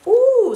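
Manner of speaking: high, loud pleased ooh; a big happy ooh after landing; delighted ooh. Acted. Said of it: "said Don Diego".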